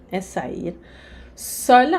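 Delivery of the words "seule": "seule" said louder and higher; "seule" set apart with emphasis; emphasized word. Shown as a demonstration. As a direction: lift and hit "seule". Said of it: "aqui é sozinha".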